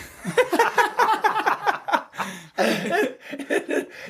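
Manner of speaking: long high burst of laughter. Real, not acted. After the steroids punchline, long laugh. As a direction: let the laugh ring out.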